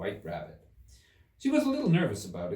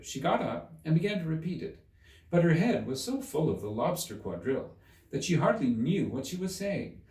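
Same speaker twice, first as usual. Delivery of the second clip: plain reading voice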